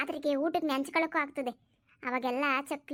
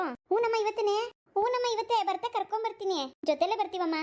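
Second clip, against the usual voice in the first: put-on child's voice; 6 semitones higher than usual. Child answering mother in skit.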